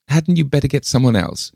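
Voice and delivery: high, practical suggestion; advising lady visitor. A narrator's reading. Miss Pym advises.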